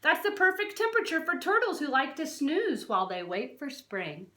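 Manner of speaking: ordinary talk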